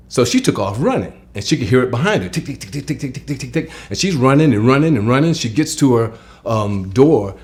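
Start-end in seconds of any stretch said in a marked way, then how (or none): 2.3-3.7 higher, quicker soft ticking; quickening ticks. Retold or imitated. Telling the chase, ticks quicker and higher. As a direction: speed the ticks up as the chase builds. Claws chasing her.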